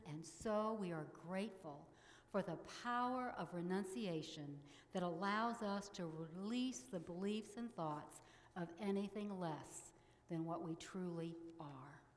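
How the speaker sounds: ordinary delivery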